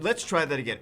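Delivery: ordinary talk, nothing marked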